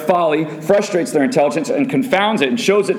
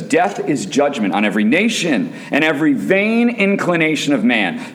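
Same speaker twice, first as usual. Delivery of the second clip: usual speaking voice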